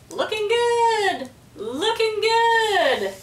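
high, slow chattering parrot echo. A narrator's reading. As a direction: parrot repeats it, drawn out. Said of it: "chattered Petey".

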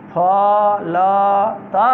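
फलतः stretched very slowly; very slow classroom reading of फलतः; very slow demo. Shown as a demonstration. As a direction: draw फलतः out syllable by syllable. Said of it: "teacher models word very slowly".

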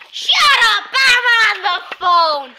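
loud, high, drawn-out mock shout; the mom's exaggerated holler in a kid's joke; mock yell. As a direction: yell it high and let it fall, stretching the words. Mom on phone snaps, joke.

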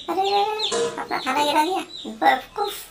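high, loud scolding surprise; cat scolding the chick for dropping its food; exasperated shock. Acted. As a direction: gasp then scold the clumsy chick.

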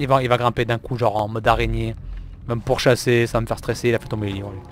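ordinary talk, nothing marked

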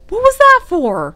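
high, loud yelp of protest; indignant cry. A narrator's reading. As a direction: cry out like you've just been smacked. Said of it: "cried the gargoyle".

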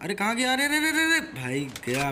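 usual speaking voice

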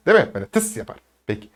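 usual speaking voice

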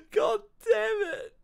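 loud, high tearful curse; overcome, voice shooting up as you cry; real crying. Real, not acted. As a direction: curse through the tears, drawn out. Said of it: "he's making me cry".

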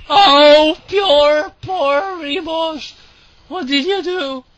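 mock pity. Real, not acted. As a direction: lament the poor little units in a high voice. Mock pity for doomed units.

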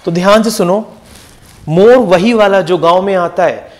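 usual speaking voice

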